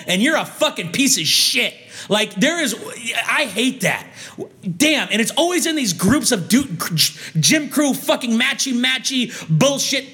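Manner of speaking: ordinary talk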